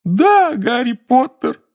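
high, slow wailing house elf. Acted. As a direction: wail it in terror. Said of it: "взвыл Добби".

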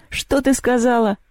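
high-pitched, scornful demand; contemptuous. A narrator's reading. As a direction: challenge her with disdain. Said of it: "презрительно спросил Перун".

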